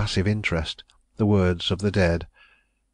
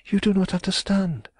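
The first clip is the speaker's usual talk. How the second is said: put-on high, pleading young woman's voice; a cornered young woman begging off; distressed young woman; 13 semitones higher than usual. A narrator's reading.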